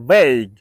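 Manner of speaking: vague said veyg, low and firm; correct pronunciation. Shown as a demonstration. Teacher modelling vague in a low firm voice. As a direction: drop low on a single syllable. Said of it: "I can repeat the word".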